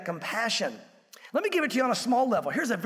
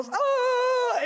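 high, drawn-out "ah" of panic; high-pitched comic yelp of alarm; mock alarmed cry; 3 dB louder than usual. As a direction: act out a driver's sudden fright. Driver suddenly passes a cop.

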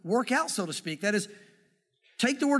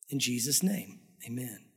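low, soft prayer ending; hushed prayer close; 9 semitones lower, 5 dB quieter than usual. Real, not acted. Pastor closing a prayer for the congregation, low and hushed. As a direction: let it sink down to a quiet amen.

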